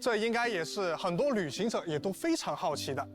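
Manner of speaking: ordinary talk, nothing marked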